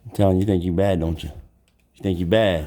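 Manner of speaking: low tough-guy tease; low taunt. Real, not acted. Teasing the mean bird in a low tough voice. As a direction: drop low and dare the bird.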